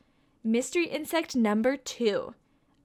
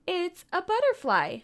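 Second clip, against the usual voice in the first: higher, lively announcement; excited reveal; 8 semitones higher than usual. Pretend. Teacher telling children the answer, excited. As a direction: pop out the answer with glee.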